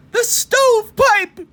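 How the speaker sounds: high loud alarmed cry; gasped alarm. Acted. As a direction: javelina spots coyote in the stovepipe, high alarmed cry. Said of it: "gasped the third little javelina".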